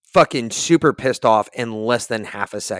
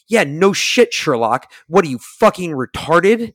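loud, higher-pitched sarcastic retort; scornful outburst; 5 semitones higher, 5 dB louder, slower than usual. Retold or imitated. Traveler snaps back at airline agent, loudly scornful.

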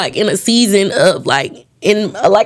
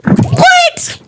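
high, loud disbelieving what; shocked at the guest's answer; much higher, louder what; surprised; 3 dB louder than usual. Real, not acted. Host reacts to surprising answer.

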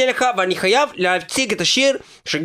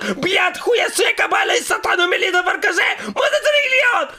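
put-on higher and higher shouting in a Russian accent, cursing; furious Russian rant; 6 semitones higher than usual. Immigrant demon venting about everything, escalating Russian-accented shouting.

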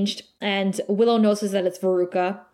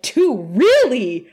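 mock surprise; 3 semitones higher, 7 dB louder, slower than usual. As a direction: react with exaggerated, punchy disbelief. Acting out his startled reply.

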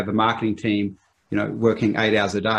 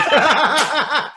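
high, loud burst of laughter; 14 semitones higher, 6 dB louder than usual. Real, not acted. Guest told to hurry up, loud high laugh. Joking over time pressure.

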